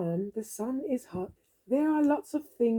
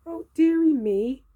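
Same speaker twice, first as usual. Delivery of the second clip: put-on fussy old dear; 6 semitones higher, 5 dB louder than usual. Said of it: "fussing character's exclamation".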